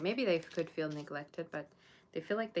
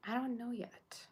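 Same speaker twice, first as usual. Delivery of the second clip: whispered aside; 6 semitones higher, slower than usual. Real, not acted. Answering a viewer's Halloween question.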